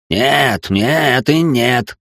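irritated, drawn-out refusal. A narrator's reading. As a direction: snap out each no, slowly. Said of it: "услышал я раздражённый голос". (раздражённо, медленно)

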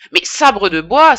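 loud, slow outraged oath; indignant exclamation. Acted. Priest protesting loudly to the pharmacist. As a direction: explode in protest. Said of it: "s'écria l'ecclésiastique".

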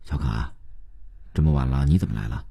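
lightly voiced father, low-pitched; father's voice. A narrator's reading. Father surprised by daughter's late visit, low fatherly voice. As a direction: ask quietly in a slightly fatherly low register.

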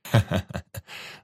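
short acted chuckle; merry laugh. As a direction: laugh like an amused warrior. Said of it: "Весело рассмеялся воин".

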